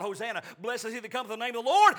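fast, loud acclamation rising to the end; crowd's shout. Acted. Crowd finally glorifying Jesus at the triumphal entry, shouted praise. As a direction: proclaim it in a quick swelling shout. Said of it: "They're finally gonna shout".

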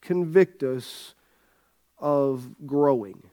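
low, measured prayer voice; spoken prayer. Acted. Pastor leading the congregation in prayer, low and earnest. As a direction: pray softly and low, with pauses.

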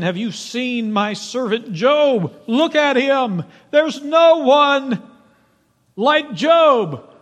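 high, loud and slow proud boast; God to Satan, proudly boasting. Acted. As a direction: show off your servant with delight.

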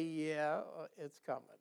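low, soft, unbothered shrug in the voice; calm acceptance. Acted. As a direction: let it drop quietly, not worked up. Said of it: "stop being all worked up".

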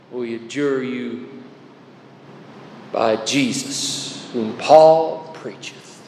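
low, slow, spell-like chant of the words; a hint of a conjurer's incantation; mock incantation. Shown as a demonstration. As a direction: intone it like a magic formula. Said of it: "Jesus is not an incantation".

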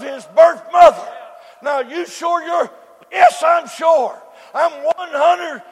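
loud, rising preacher cadence, hitting key words; preaching at full force. Acted. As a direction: whoop it up to the congregation. Preacher building the zebra illustration.

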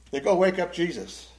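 usual speaking voice

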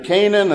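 Canaan said as KAY-nun; long A up front in Canaan, KAY-nun; American pronunciation. Shown as a demonstration. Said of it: "as a lot of Americans say".

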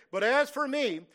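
forceful divine declaration; angry God. Retold or imitated. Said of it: "come from his anger, terrify them in his fury".

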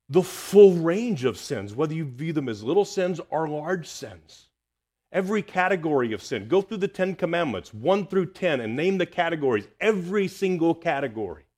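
urgent pulpit proclamation; full-force preaching. Acted. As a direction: pound each phrase like a revival preacher.

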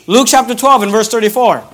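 normal talking voice

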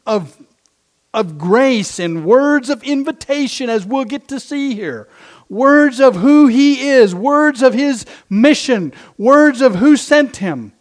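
loud, rising preacher cadence; preaching at full force. Acted. Preacher exalting the Savior's words to the congregation, loud.

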